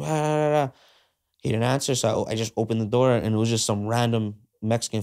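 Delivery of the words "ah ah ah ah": shouting a friend's name across the street after a robbery; calling out. Retold or imitated. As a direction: call out for a friend who might be inside. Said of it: "calling his name".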